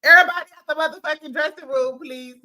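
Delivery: loud, fast, high order; mock shout. Doctor Jackie clearing the room, mock shout. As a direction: hurry them out. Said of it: "Doctor Jackie pushed and rushed".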